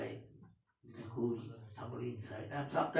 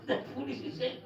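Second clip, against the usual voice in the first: real helpless laughter, higher; laughing; 12 semitones higher than usual. Real, not acted. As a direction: tell it while you can't stop laughing. Laughing through the story.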